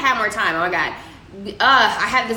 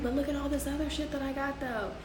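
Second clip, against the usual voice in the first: a softer, quicker sly pitch for the extra goods; sly aside; 3 semitones higher, 10 dB quieter, faster than usual. Retold or imitated. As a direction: slip in the extras under your breath. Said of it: "be like".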